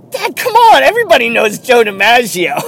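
put-on high, loud seven-year-old's protest; exasperated kid. Son telling his dad the obvious, loud high mock exasperation. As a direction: imitate a boy loudly scolding his dad. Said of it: "looks at him and goes".

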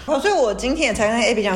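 ordinary talk, nothing marked

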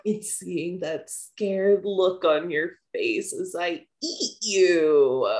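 a mild hammed-up villain gloat; menacing villain. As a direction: savor each word like a predator. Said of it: "she's like".

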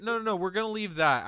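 talking over the group; loud insistence. Real, not acted.

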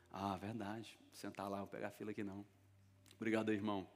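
soft, low giving-in; acted meek thanks, softly; quiet acquiescence. Pastor giving in to being honoured.

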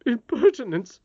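high, forceful exclamation; indignant mutter. Acted. Nurse objecting to the boy's offer, indignant. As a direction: huff it as an affronted nurse. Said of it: "murmured the nurse, but she did not say it aloud".

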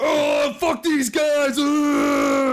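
high mock shout of fuck these guys; mock yell. Podcast host yelling at imagined online critics, mock angry shout. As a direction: yell it out in playful fake fury.

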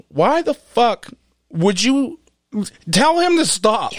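high, slow, disbelieving demand; retelling his disbelief to the podcast hosts, high and drawn out; incredulous retold question. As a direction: stretch it out in shock at your friends. Retells asking friends about Pills.

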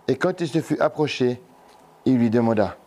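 ordinary talk, nothing marked

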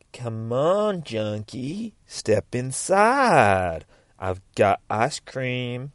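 very slow, drawn-out singsong lure; beckoning ice cream van. Acted. Ice cream van luring a junkie inside, beckoning. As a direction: tempt with stretched, swooping words. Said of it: "ice cream van's there going".

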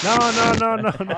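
high, loud run of protest; alarmed shouting. Real, not acted. Podcaster protesting at a friend's blaring mic, alarmed shouting. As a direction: cry out in real alarm at the racket. Stopping friend's noisy microphone.

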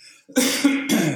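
loud sudden cough; coughing fit. Real, not acted. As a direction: cough hard into the mic. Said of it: "musste kurz husten".

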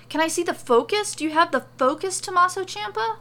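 fast, high, loud questioning; breakneck, overexcited begging; excited pleading. A narrator's reading. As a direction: rattle it out eagerly. Excited character begs to see the item.

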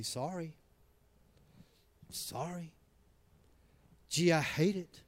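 soft, grudging mock sorry; sarcastic apology. Shown as a demonstration. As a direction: toss off the apology as if you don't mean it. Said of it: "in a sarcastic way".

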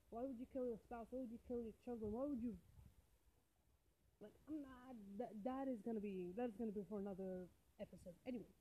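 ordinary talk, nothing marked

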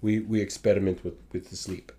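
low, mock Russian accent; a lightly hammed-up Russian comrade. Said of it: "you start with your fucking Russian voice".

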